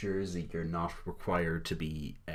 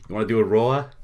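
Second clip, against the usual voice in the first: loud, rising mock belt; belted catchphrase; 5 semitones higher, 12 dB louder than usual. Echoing the chat guy's catchphrase at full volume. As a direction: belt it out like there's no tomorrow. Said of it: "He belts them out like there's no tomorrow".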